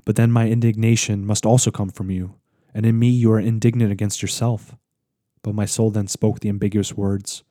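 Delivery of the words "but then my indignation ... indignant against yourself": plain reading voice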